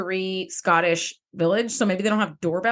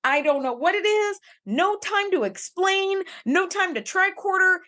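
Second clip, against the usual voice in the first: high-pitched, insistent pleading at the screen; mock urgent warning; 8 semitones higher than usual. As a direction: urge them to flee, playfully exasperated. Urging a show character to flee.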